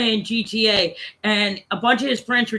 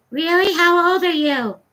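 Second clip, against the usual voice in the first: put-on little kid; 7 semitones higher than usual. Little kid asking an adult's age, mimicked child voice. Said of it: "I can hear this little kid".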